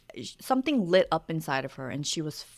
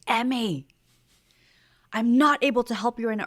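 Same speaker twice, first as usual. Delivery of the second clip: sharp raised voice at her daughter; 6 semitones higher, 5 dB louder than usual. Retold or imitated. Mother losing patience at the pool, raised voice. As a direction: snap at the child, loud and high. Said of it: "but I raised my voice".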